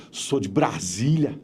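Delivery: Brasília accent. Shown as a demonstration. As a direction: say it like someone from Brasília, slowly. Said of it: "Se eu fosse de Brasília".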